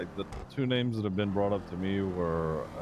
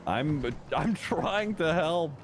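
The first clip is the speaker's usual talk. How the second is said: high, loud, drawn-out protest; stretched-out, high-pitched insistence; oddly insistent; 9 semitones higher, 5 dB louder, much slower than usual. Real, not acted. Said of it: "You're being weird".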